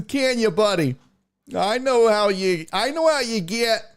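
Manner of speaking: high, sing-song trash talk; taunting. Acted. Host telling Clarence he can't handle losing, taunting. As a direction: needle a rival in a high-pitched tease.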